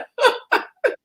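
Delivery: high-pitched laugh; real high-pitched chuckling. Real, not acted. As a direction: laugh high at your own joke. Laughs at seasoning joke.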